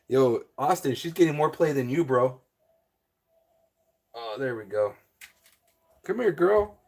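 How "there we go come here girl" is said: normal talking voice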